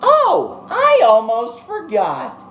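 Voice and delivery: very high, slow, cartoony bear voice; squeaky, gleeful mascot villain; Monokuma voice. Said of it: "I can't do the Monokuma voice".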